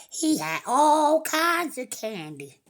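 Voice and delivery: usual speaking voice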